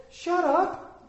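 very high-pitched mock telling-off; overdone impression of an exasperated wife; exaggerated scolding wife. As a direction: cut in with a hammed-up, high wifely scolding. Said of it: "Not quite like that. She's much nicer".

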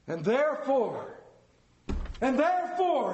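rising, hammed-up Scrooge cry. A narrator's reading. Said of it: "he cried".

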